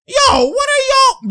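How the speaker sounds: very high, loud and slow cry of disbelief; real frustrated outburst; outraged yell. Real, not acted. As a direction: yell at the team for missing another rebound. Outraged at team's defense.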